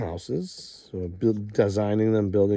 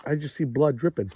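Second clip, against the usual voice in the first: ordinary talk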